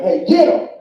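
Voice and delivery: mock alarmed yell; alarmed shout. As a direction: yell at your reflection to catch him. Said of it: "I'm looking in the mirror like".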